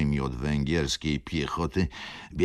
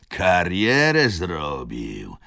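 thick voice; 3 semitones higher, 8 dB louder, much slower than usual. A narrator's reading. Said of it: "mówił gruby głos".

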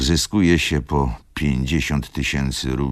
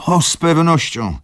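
high, louder eager exclamation; exclaimed; 13 semitones higher, 5 dB louder than usual. A narrator's reading. Old Tomasz eagerly exclaims to Wokulski, higher and louder. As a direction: Tomasz bursts out in agreement. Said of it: "zawołał pan Tomasz".

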